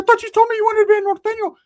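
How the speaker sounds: high, loud know-it-all voice; bossy Ricky. Retold or imitated. Ricky accusing him.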